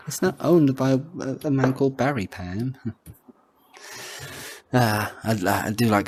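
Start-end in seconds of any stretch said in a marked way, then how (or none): none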